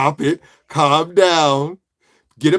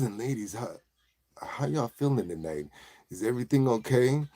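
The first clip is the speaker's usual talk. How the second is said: soft, lowered, suave check-in; smooth ladies' man; 3 semitones lower, 10 dB quieter than usual. Pretend. Shirtless cook asking ladies how they feel, suave and soft. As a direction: play a mellow cooking host wooing softly. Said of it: "acting like I'm one of those guys".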